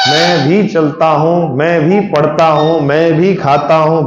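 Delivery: slow, every भी lifted and leaned on; stress on bhi. Shown as a demonstration. Teacher to class, showing emphasis: भी stressed slowly. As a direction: lean on भी in each line. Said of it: "भी लगा रहे हो आप यहाँ".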